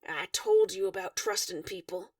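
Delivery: usual speaking voice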